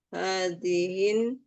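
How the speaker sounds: Hazihin slowly, with a clear final n; slow recitation demo. Shown as a demonstration. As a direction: hazihin drawn out evenly. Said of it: "Ulang lagi".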